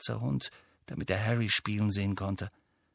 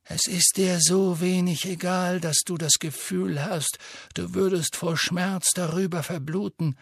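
put-on gentle old headmaster; 7 semitones higher than usual. A narrator's reading. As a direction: comfort the boy, unhurried. Dumbledore calm reply.

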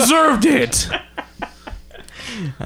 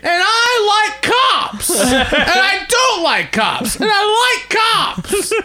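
mock flip-flopping; 7 semitones higher than usual. Mocking flip-flopping opinions.

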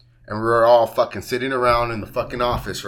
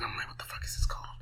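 hushed whisper to himself, searching for a word; whispered thought; 7 semitones lower, 19 dB quieter, faster than usual. Retold or imitated. Whispering to himself as he forgets the word, hushed. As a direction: whisper it like you're racking your brain.

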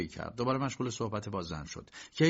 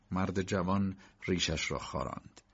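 ordinary delivery

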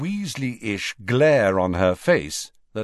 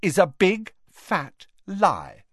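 calm but emphatic denial, higher pitch; 10 semitones higher, 3 dB louder than usual. A narrator's reading. Ginny rebuts her brothers' gossip, calm. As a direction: deny it calmly, weighting each final word. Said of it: "said Ginny calmly".

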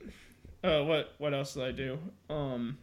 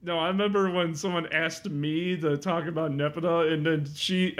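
normal talking voice

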